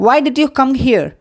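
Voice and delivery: very slow, each word spaced; slow model phrase. Shown as a demonstration. Teacher to Tamil learners, slow clear model question. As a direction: spell it out slowly to copy. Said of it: "teacher models English phrase twice".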